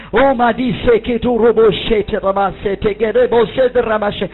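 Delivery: loud, rising prayer confessions with tongues; fervent prayer aloud. Acted. Preacher leading the congregation in loud confession and tongues. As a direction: lift the voice, declare every line.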